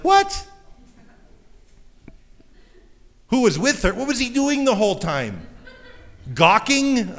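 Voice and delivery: high, loud incredulous what, who, gawking; shocked disbelief. Retold or imitated. Preacher recalling first reading the verse, stunned and high. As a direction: react like you can't believe he just stood there. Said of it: "I was like".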